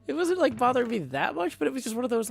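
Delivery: high, loud, flustered; exasperated. Real, not acted. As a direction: rant it with a laugh. Exasperated ranting.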